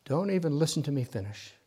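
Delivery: ordinary talk, nothing marked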